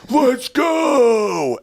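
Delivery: strained high-pitched hype yell; mock scream. Host mimicking sports fans after a score, mock scream. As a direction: imitate an overexcited fan screaming, drawn out. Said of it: "they'll just scream".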